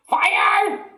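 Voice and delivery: put-on very high, loud old woman's shriek; panicked shout. A narrator's reading. Grandma shrieking for help, very high and loud. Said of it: "the old woman yelled".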